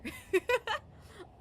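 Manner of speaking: bright burst of high laughter. Real, not acted. Laughing at her own quip to the listener, high. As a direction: laugh at your own joke.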